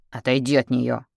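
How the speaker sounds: firm, commanding order; stern warning. A narrator's reading. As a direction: order him away from her. Blade-drawn warning in a quarrel. (грозный приказ)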